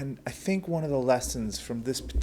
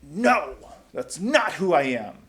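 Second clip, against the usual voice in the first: loud flat refusal; much louder indignant no; blustering denial; 6 semitones higher, 12 dB louder, slower than usual. Retold or imitated. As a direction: bluster rising up in pride. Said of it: "that bluster that rises up".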